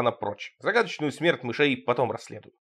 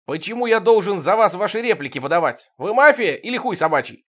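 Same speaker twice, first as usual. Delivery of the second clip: loud, higher, demanding through a door; indignant demand; 6 semitones higher, 5 dB louder than usual. Acted. Narrator at the door, demanding loudly. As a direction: snap at the person outside.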